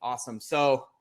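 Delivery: ordinary delivery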